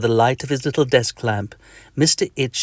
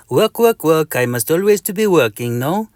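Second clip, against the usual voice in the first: put-on a mosquito with a comic French accent, higher; 5 semitones higher, 4 dB louder than usual. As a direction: greet him in a cartoon French accent.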